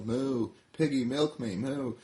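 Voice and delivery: a cow begging Piggy; lightly voiced pleading cow; Daisy the cow. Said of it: "says Bessie Mae's friend Daisy".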